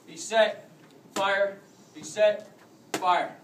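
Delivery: ordinary delivery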